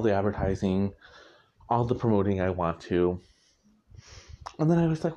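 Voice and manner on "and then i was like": ordinary talk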